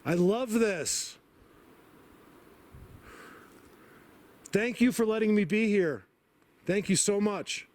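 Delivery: high, slow and choked up; crying thanks; tearful thanks. Real, not acted. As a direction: overcome with emotion, voice cracking. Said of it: "losing it emotionally".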